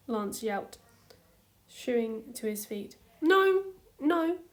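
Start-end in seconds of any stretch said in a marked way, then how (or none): 3.2-4.4 high, loud panicked no; shouted denial. A narrator's reading. Hunk defending himself to Lance, loud and high. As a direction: shout it out in alarm. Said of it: "Hunk shouted".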